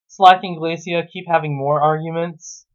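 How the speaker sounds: usual speaking voice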